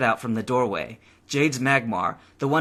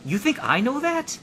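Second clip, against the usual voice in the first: exasperated; 14 semitones higher than usual. A narrator's reading. Jade exasperated.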